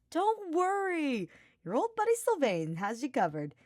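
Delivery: cocky friend taking charge; Sylvain, grinning and sure. Acted. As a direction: stretch out the reassurance. Sylvain reassuring.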